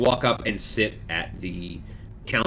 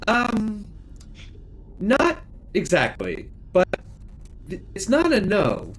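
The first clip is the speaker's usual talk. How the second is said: higher-pitched, hesitant hostess; lightly voiced hostess; 10 semitones higher than usual. Hostess asked if she is also chef, mildly puzzled. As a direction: answer puzzled, pitched up a little.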